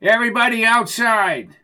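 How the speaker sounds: high, loud police command; loud officious holler; shouted order. Acted.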